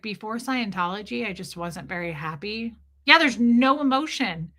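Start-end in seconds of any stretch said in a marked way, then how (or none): 0.0-2.7 softer, dead flat imitation; mock the lifeless testimonial; low-energy droning quote; flat monotone. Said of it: "he was like".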